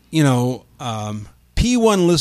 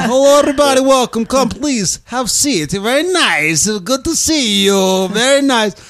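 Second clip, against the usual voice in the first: put-on high-pitched, thickly accented comic welcome; hammed-up foreign accent, pitched high; Borat; 8 semitones higher than usual. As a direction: usher students in as an eager mock foreigner. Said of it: "in my best Borat voice".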